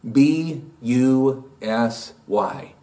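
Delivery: B U S Y, each letter spelled out slowly and separately. Shown as a demonstration. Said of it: "you know what busy is?".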